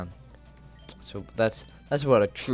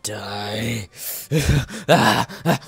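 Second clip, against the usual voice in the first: crazed mock outburst; drawn-out word, then loud wild cries; going crazy; 4 dB louder, much slower than usual. Gamer telling opponent to die, crazed. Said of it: "I got a little crazy there".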